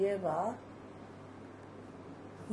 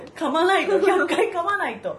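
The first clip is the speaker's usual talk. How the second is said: excited; 8 semitones higher, 7 dB louder than usual. Real, not acted. Playful chant laughing.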